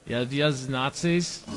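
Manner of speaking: a hint of clumsy German accent; mock a bad film German accent lightly; half-English, half-German accent. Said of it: "had the worst German accents".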